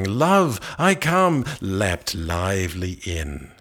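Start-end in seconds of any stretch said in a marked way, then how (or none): 0.0-1.4 high, loud cry as he leaps in; an eager lover's ringing shout. A narrator's reading. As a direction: fling the words out boldly, full voice. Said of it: "and crying".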